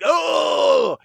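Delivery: short high loud yelp of fright; startled scream. Retold or imitated. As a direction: jump at the raccoon. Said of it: "Lindsay Lohan just screams".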